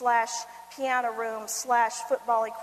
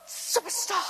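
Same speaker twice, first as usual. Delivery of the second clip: awed superstar; 7 semitones higher, slower than usual. Retold or imitated. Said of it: "and I went".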